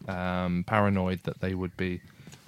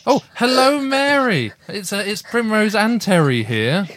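much higher, bright phone hello; excited greeting; 11 semitones higher, 5 dB louder than usual. Real, not acted. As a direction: greet her with big delight. Sing-song phone greeting.

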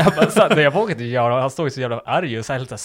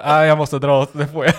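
mock loud excuse; 5 semitones higher, 9 dB louder than usual. Retelling his exhausted excuse.

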